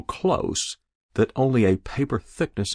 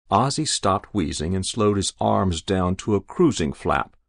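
plain reading voice

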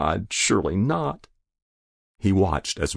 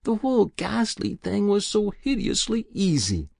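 put-on higher, older woman's confessing voice; a guilty old woman pleading with the inspector; elderly woman voiced higher, pained confession; Muriel's voice; 12 semitones higher, 3 dB louder than usual.

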